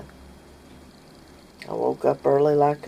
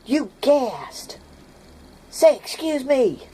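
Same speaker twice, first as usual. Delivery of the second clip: put-on girls yelling; 10 semitones higher, slower than usual. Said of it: "Both of 'em yelling this".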